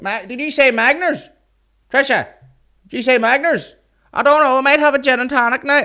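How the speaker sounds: very high, loud mock holler; screechy-high caricature calling back to a friend; imitating shouting punter. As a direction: mimic a punter yelling a drinks order across the room. Imitating punter calling across room.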